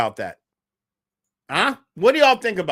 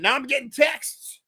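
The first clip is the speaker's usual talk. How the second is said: usual speaking voice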